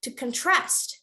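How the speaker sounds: "contrast" with strong TRAST; stress on second syllable. Shown as a demonstration. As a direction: model "contrast" as a verb, con-TRAST. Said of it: "If it's a verb".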